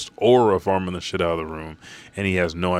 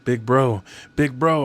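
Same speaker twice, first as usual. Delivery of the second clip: higher, louder cry of a name; mock scream; 6 semitones higher, 5 dB louder than usual. Brook cries out to his fallen brother, mock scream. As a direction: call out to him in grief, half-screamed. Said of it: "Brook starts to scream".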